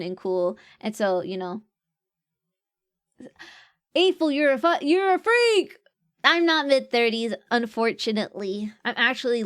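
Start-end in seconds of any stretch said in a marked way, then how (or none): 3.9-5.8 higher, loud playful accusation; mock outrage. Streamer teasing viewer April about her age, in mock outrage. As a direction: tease her like she's scandalous.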